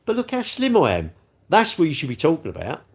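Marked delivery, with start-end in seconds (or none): 0.1-1.1 mock smug boast, much higher; a high, pleased-with-herself brag; hammed-up smug voice while munching biscuits; smug quote. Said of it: "and then go".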